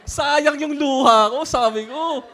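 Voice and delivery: slow, loud words through real laughter; laughing. Real, not acted. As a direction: laugh it out, amused at the wasted tears. Laughing at own tears. (tumatawa habang nagsasalita)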